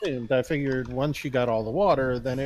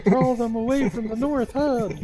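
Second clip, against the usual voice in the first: higher, louder put-on voice; different silly voice; 10 semitones higher, 7 dB louder than usual. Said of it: "I even did it in different voices".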